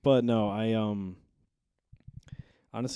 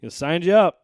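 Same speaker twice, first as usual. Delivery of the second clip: loud friend voice; 11 semitones higher, 17 dB louder than usual. Retold or imitated. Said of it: "I was like".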